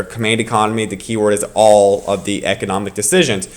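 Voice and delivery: ordinary delivery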